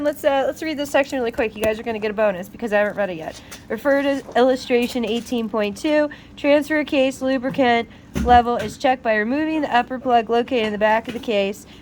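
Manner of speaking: ordinary delivery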